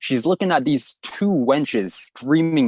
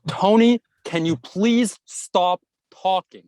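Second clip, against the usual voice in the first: high, slow, scolding teacher voice; mock stern coordinator, pitched way up; imitating the coordinator; 7 semitones higher, much slower than usual. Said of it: "And she's like".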